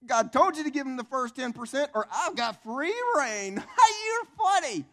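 loud, high mocking jeer; hammed-up gloating with forced laughs; Satan. Devil laughing at believer's rebuke, mocking high jeer. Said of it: "And Satan goes".

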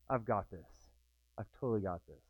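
soft, low, to yourself, sure you've got it; hushed confident inner voice; quiet self-talk. Retold or imitated. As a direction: murmur it as a private boast. Imagined confident inner thought.

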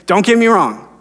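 ordinary talk

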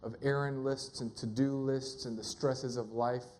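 low, soft, unhurried prayer; hushed, low-pitched pastoral prayer. Real, not acted. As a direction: pray softly and low, pausing between phrases. Leads a prayer.

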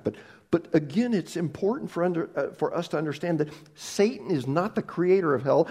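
ordinary delivery

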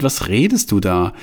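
ordinary delivery, nothing marked

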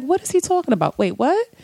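high-pitched confused reaction; baffled. Retold or imitated. Acts out confusion at the lyrics.

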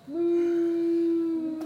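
playful mooing cow. Pretend. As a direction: moo like a cow.

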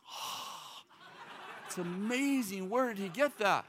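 breathy, laughing awe; amazed. Retold or imitated. Listener hearing the pastor, awed laughing wonder. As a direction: marvel at it, half laughing. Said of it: "you're like".

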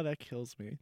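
usual speaking voice

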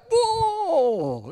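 high, drawn-out whoa; taken aback. Retold or imitated. Pastor reacting to a swearing visitor, startled high whoa.

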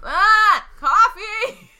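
high whiny wail for coffee. Acted. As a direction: whine for it like a needy patient. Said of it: "So whiny".

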